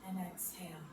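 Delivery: breathy whisper on the exhale. Shown as a demonstration. Teacher guiding kids' breathing, whispered. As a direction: let the word fade out like a breath.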